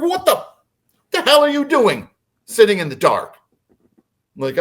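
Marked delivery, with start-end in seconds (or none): 0.0-3.4 high-pitched retold scolding; incredulous, angry demand; angry dad. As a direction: imitate a father exploding at the kids. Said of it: "And he's angry".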